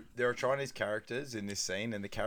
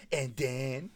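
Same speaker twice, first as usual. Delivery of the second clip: sassy, drawn-out mock taunt; imitating a sassy order-taker; 3 semitones higher than usual. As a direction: toss it out with sass, stretched. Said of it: "a sassy and then".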